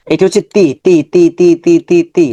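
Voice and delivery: clear, repeated Arabic syllables, each one said crisply; syllable drill. Shown as a demonstration. Teacher drills students on letter sounds, crisp repetition. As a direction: sound out তি again and again for the class. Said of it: "আমরা এইভাবে practice করবো".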